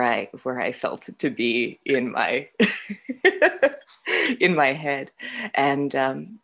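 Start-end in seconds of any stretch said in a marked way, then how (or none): 2.5-3.8 laughing. Real, not acted. Laugh mid-sentence.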